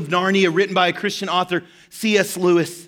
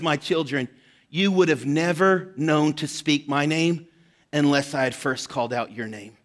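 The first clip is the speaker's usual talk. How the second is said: gentle lion; 4 semitones lower, 4 dB quieter than usual. Acted. The lion Aslan answers children.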